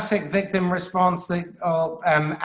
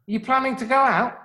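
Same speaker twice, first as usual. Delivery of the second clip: bright and higher, friendly greeting; cheerful parent; 6 semitones higher than usual. Shown as a demonstration. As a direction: greet the son lightly, pitched up.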